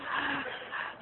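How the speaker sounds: quiet, voiceless chuckle; short laugh. Real, not acted. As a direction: chuckle softly at your own anecdote. Laughs at own anecdote.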